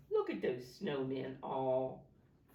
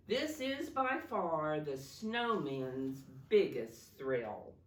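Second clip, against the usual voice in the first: ordinary delivery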